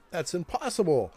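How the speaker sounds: high, loud protest; acted disbelief. Dwarf told he barely got a scratch, acted disbelief. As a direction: blurt it out in hammed-up disbelief. Dwarf's startled reply, read aloud.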